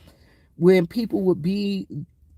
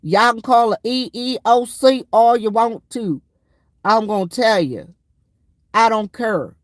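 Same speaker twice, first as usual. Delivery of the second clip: louder, higher ranting sergeant in formation; mock drill sergeant rant; 3 semitones higher, 5 dB louder than usual. First sergeant ranting at the troops in formation, loud mock bark. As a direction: bark it like a first sergeant who doesn't care. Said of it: "he'd be like".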